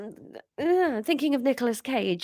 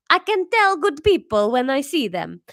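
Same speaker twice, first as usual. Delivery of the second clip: higher, louder Italian mother; Italian accent; 6 semitones higher, 5 dB louder than usual. Acted. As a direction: warmly insist in Italian accent.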